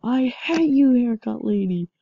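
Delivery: high, loud I hate you; angry yell. Acted. Gamer shouting at haircut lady target, angry yell. Yelling grudge at game target.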